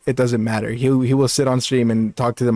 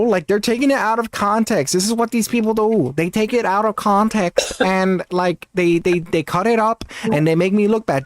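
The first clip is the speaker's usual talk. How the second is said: higher-pitched aggrieved streamer impression; imitated streamer cadence; 6 semitones higher than usual. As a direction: protest in the streamer's put-on cadence. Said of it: "the exact same shit where it's like".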